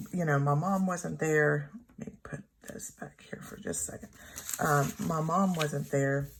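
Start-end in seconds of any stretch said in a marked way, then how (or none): 2.0-4.1 quick whispered aside, off to the side. Real, not acted. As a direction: whisper it fast to someone nearby. Aside to someone off camera.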